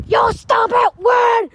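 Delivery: usual speaking voice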